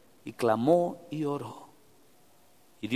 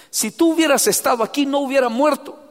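Martha's reproach; 11 semitones higher, 7 dB louder than usual. Retold or imitated. Martha reproaching Jesus, voiced.

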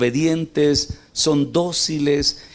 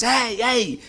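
calling out to sheep; 9 semitones higher than usual. Retold or imitated. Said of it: "se puso a gritarles".

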